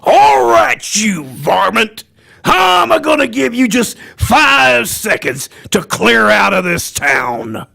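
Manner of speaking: hollering cartoon Western outlaw; Yosemite Sam. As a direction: holler threats, then get stuck on the count. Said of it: "Yosemite Sam, which is one of the harder ones".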